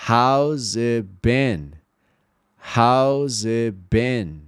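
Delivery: very slow "it" without its T; drawn-out model with silent T in "it". Shown as a demonstration. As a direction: stretch each word, dropping the T of "it". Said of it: "sem a letra T".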